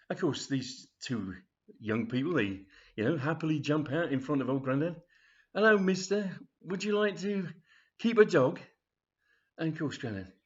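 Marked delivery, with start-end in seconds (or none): 5.5-8.6 high, loud charity collector greeting; acted chirpy doorstep appeal; eager street pitch. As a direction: accost a passer-by with gusto. Young collectors accost Grandad brightly.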